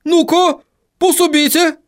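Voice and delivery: high dwarf call for help; high-pitched dwarf command; urgent dwarf. Acted. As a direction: call the others over in a high, strained voice. Dwarf calls others to help.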